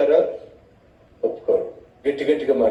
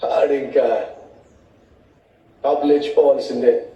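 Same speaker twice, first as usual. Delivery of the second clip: normal talking voice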